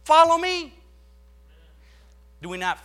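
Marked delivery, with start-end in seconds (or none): 0.0-0.7 loud, high follow me; shouted command. Acted. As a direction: hit it hard and high. Christ's punchline, shouted.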